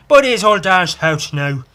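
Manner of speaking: loud, high stage Irish; exaggerated mock-Irish brogue; bad Irish accent. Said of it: "that's my Irish accent".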